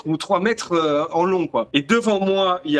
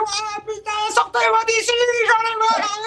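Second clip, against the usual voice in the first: cri aigu et désespéré contre les barreaux; gueulant à la grille. Retold or imitated. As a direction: hurle pour qu'on te sorte de là, très haut. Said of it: "je commence à gueuler à la grille". (crier comme un forcené à la grille)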